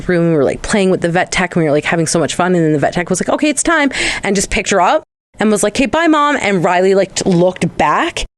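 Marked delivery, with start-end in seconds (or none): 3.3-3.9 a light, higher, cheerful vet tech; lightly voiced vet tech. 5.7-6.4 a light, higher, cheerful vet tech; lightly voiced vet tech.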